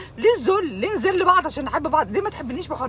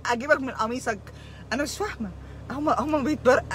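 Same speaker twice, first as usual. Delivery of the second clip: usual speaking voice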